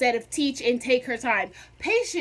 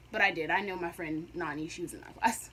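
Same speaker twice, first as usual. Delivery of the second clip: usual speaking voice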